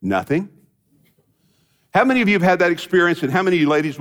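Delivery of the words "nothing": low-pitched, understated husband's answer; low, mildly vacant reply; blank husband. Acted. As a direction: lightly play a man with nothing on his mind. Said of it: "have him look back at you and say".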